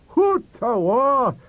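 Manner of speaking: loud, high, slow Scots cry; shouting. Acted. Librarian shouting from down the table, loud Scots. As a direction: belt out Hoot awa. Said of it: "shouted our librarian".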